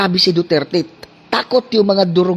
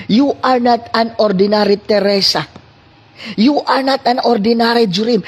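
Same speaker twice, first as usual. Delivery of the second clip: starting level, then climbing higher and louder with each name; building sermon; 6 semitones higher, 3 dB louder than usual. Acted. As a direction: lift the congregation up line by line. Preaching builds to climax. (lumalakas na pangangaral, tumataas sa bawat pangalan)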